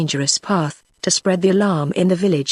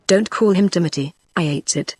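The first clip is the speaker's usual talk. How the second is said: ordinary delivery, nothing marked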